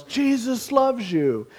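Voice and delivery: slow, high-pitched call into a mailbox; booming mock shout into an echoing mailbox; high drawn-out yell. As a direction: stretch it out high like calling through a megaphone. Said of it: "he yells".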